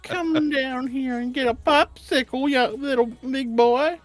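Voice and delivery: put-on creepy old man, high and slow; Herbert the pervert. Friend taking a turn at the creepy man, high-voiced. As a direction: stretch it out in an old man's falsetto. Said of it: "do the old man's voice".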